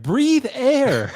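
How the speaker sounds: quoting the meme with a laugh in the voice; Andrew Tate meme. Retold or imitated. Friend quoting the Andrew Tate meme, mocking tone. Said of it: "that Andrew Tate meme where he's like".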